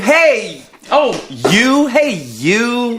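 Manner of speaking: loud, high, overjoyed hey you; loud fake greeting. Singer mocks greeting strangers who stare, loud and high. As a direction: hail them like an old friend.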